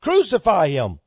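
high, loud preacher's cry. Retold or imitated. Preacher retelling the crowd at the crucifixion, loud cry. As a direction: retell the mob's cry at full pulpit volume. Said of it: "They cried out".

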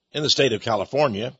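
ordinary delivery

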